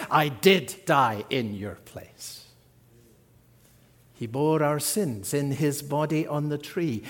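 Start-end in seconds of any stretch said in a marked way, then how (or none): 0.1-2.5 calm answer. Acted. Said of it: "Jesus says".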